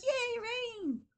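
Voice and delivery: high-pitched drawn-out cheer; happy little boy. Acted. Teacher imitates boy for the class, high gleeful cheer. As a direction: squeal it like an overjoyed kid. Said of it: "But the little boy's like".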